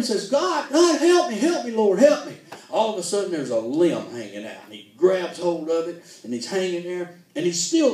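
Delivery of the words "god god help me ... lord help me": pitched high, louder, hamming up a man falling; mock panicked cries for help. A falling man calls to God for help, in mock panic. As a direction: cry out to God like you're slipping off a cliff.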